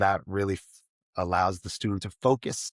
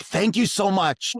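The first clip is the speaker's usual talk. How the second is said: high, loud, slow delighted boss; grateful boss; 10 semitones higher, 5 dB louder, slower than usual. Retold or imitated. Boss thanking the young programmer, high and gushing. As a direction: gush thanks like a relieved boss. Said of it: "And he's like".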